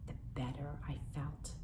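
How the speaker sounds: breathy whispered line, sinking into calm. A narrator's reading. Boy sinking into bathwater, whispering. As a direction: let it fade into a whisper.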